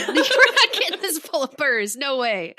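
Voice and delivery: loud, high laughing protest. Retold or imitated. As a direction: laugh through a playful refusal. Laughing, retelling her protest.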